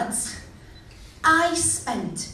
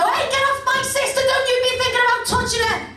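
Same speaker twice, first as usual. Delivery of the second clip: high, loud mock threat; hammed-up angry warning, pitched up; protective brother shout; 12 semitones higher, 6 dB louder than usual. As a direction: bark it like an overprotective big brother. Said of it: "I bet they want to go up to him and go".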